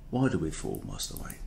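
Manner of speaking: put-on deep, hushed elderly-butler film voice. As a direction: drop it low and soft, like Alfred. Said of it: "And now it's a bit more".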